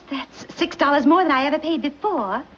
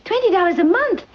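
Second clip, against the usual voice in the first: shocked repeat of the price, pitched up and louder; surprised; 6 semitones higher, 4 dB louder than usual. Acted. Mistress startled by the maid's wage demand, surprised. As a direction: balk at the number in disbelief. Maid asks for high wages.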